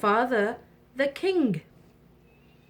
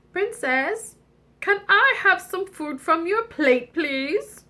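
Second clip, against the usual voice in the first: begging frog voice; 8 semitones higher than usual. Acted. Frog begs for food.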